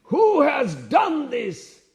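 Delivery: high, loud, drawn-out roar of a question; roaring demand. Retold or imitated. Enraged warrior confronts the assembled kings, roaring. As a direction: roar it slowly like a big lion. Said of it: "like a big roaring lion".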